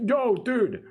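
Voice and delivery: high eager hail to the seller; mock eager call. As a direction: call out like a hooked buyer. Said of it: "So he's like".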